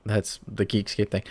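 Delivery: usual speaking voice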